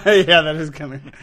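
laughing through the words. Real, not acted. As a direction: says it while cracking up. Laughing at teasing remark.

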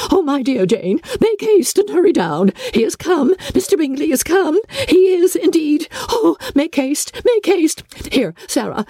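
high, excited calling to her daughter; flustered mother hurrying the girls along, pitched high; high-pitched fussing mother, calling out; crying out. A narrator's reading. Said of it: "crying out".

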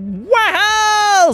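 high, long wahoo; gleeful drawn-out yell; exhilarated whoop. Acted. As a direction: cry out whizzing down a slide. Panda on the slide.

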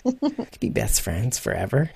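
playful hushed daydream; mock wistful. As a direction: trail off dreamily, half-joking. Joking about being the same age.

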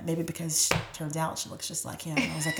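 usual speaking voice

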